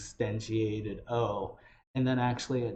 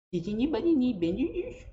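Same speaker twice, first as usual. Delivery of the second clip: much higher gnome voice with an over-enunciated lisp on "bandages"; Gimbal's lisp; 4 dB louder than usual. Shown as a demonstration. Teacher shows Gimbal's lisping gnome accent, high. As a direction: lisp "bandages" with the tongue spread, pitched way up. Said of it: "Or in Gimbal's accent".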